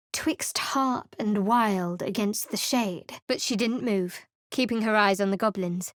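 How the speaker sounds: usual speaking voice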